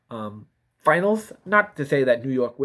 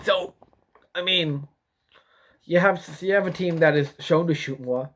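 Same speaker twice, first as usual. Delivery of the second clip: ordinary talk, nothing marked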